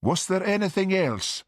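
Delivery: high, coolly formal question; a touch of frost in the voice; chilly. A narrator's reading. Hurt son ending mother's call, chilly. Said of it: "in accents as chilly as the police office".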